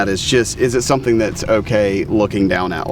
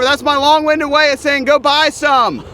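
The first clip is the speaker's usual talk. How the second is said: much louder and higher, over plane noise; repeating over loud noise; 4 dB louder than usual. Real, not acted. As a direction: raise your voice to be heard. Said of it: "You gotta speak up a little bit".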